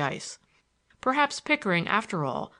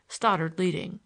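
usual speaking voice

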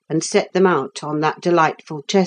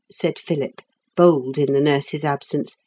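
ordinary delivery, nothing marked

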